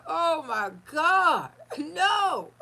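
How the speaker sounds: loud, slow, stretched-out shocked cry with a laugh; retold shock, half laughing. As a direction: cry out in disbelief at the news. Shocked at the wrecked house.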